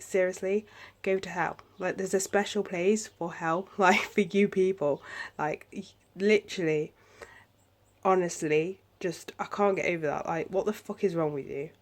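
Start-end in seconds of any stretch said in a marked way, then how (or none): none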